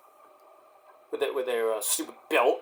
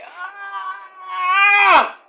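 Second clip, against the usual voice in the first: mock pain yell; 14 semitones higher than usual. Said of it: "and Randy's like".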